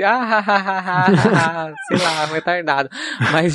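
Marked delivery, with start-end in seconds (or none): 0.0-1.7 a forced, embarrassed mock laugh, a bit higher; fake cover-up laugh. Telling friends how she laughed off her scream, forced mock laugh. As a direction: retell faking a laugh to hide the scream. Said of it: "eu fiquei rindo, tipo assim, disfarçando".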